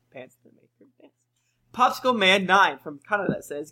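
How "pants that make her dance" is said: whispered quick savoring echo; barely audible muttered repeat; amused whisper. Shown as a demonstration. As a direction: repeat it under the breath, faster.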